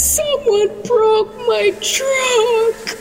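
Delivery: put-on high, drawn-out child's wail; crying little boy. Boy tells Mommy about his broken truck, wailing high. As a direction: whine it slowly through mock tears. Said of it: "Roger came inside crying. He was very sad".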